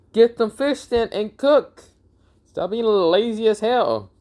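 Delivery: high-pitched mock scolding; acted nagging, voice pushed way up; exasperated scolding. Naruto tells the hungry bear to cook fish, exasperated scolding.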